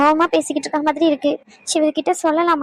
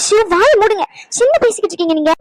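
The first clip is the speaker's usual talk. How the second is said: raised, higher-pitched shushing; sharp protest; 4 semitones higher, 3 dB louder than usual. Acted. Wife stops husband talking of being gone, sharp protest. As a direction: cut him off with a louder, urgent protest.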